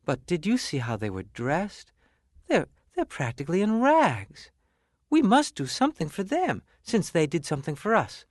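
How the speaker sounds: wife worries about the elves' rags, pitch climbing; concerned wife. Acted. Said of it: "said the shoemaker's wife".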